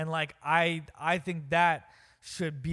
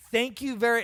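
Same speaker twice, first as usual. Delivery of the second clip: higher, louder mock showman's thanks; performer's sign-off; 7 semitones higher, 6 dB louder than usual. Co-host mimics the president after a speech, louder mock performer's sign-off. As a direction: belt out a mock curtain-call thank-you. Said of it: "Like he just did a performance".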